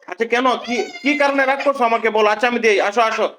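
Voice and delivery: high, sing-song coaxing voice. Real, not acted. Father coaxing his sulking daughter to come back, high and sweet.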